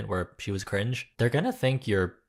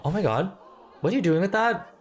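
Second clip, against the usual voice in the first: higher, alarmed gasp at the weapon; shocked townsperson; 7 semitones higher than usual. Acted. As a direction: play a startled villager.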